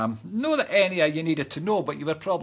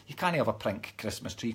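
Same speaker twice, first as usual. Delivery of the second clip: retold refusal; 7 semitones lower, 8 dB quieter than usual. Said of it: "I'm like".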